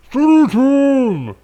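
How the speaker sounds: slow, booming voice through a mask. Retold or imitated. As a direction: drag it out like a masked boss. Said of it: "you don't have to give them the mask voice".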